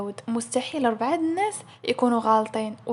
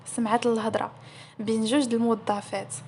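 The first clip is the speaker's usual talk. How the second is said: ordinary delivery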